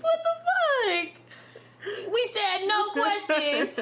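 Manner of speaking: high, slow, laughing through tears; half laugh, half sob; crying through laughter. Real, not acted. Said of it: "I'm trying not to cry".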